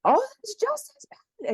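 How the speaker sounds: high, loud alarmed doctor; mock freaking-out doctor. Naturopath scolding her drink, high mock alarm. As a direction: ham up the doctor's panic. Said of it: "And he's freaking out".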